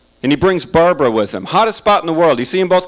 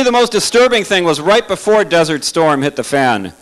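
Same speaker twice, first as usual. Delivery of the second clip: normal talking voice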